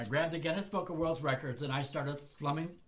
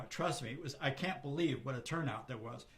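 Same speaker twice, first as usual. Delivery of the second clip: ordinary talk, nothing marked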